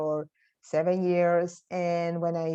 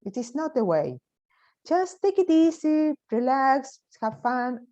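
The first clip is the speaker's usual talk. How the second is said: high-pitched coaxing teacher, imitated; retold sensei; 10 semitones higher than usual. Sensei telling a student to lighten up, imitated coaxing. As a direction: reassure a struggling pupil in a lifted, lilting voice. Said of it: "he came to me and said".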